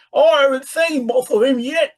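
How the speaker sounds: high put-on rustic British voice; country man. A narrator's reading. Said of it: "said Mister Skinner".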